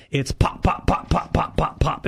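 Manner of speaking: short breathy pops like a misfiring boat engine; imitated engine popping. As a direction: pop it out like a sputtering inline six.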